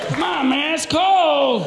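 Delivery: high, whining mock protest; naked man pleading. Imitating embarrassed naked man pleading.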